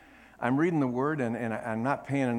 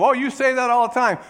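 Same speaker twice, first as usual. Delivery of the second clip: mock devil retort; 10 semitones higher, 10 dB louder than usual. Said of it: "he's not gonna say back to you".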